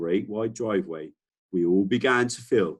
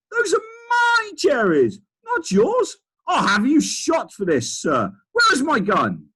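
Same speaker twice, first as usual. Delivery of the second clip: much higher, louder outraged bellow; furious Duke shouting; 8 dB louder than usual. Acted. Duke screaming for his gun at the bird, much higher furious shout. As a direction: rage like a robbed old aristocrat. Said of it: "the Duke was shouted".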